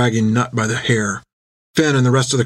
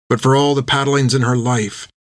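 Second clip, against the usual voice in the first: ordinary delivery, nothing marked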